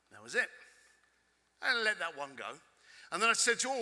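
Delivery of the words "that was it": usual speaking voice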